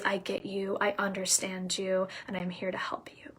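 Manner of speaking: tender empowering voice falling to a hush; calm reassurance. Shown as a demonstration. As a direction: reassure them quietly and steadily. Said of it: "her power is infused in her voice".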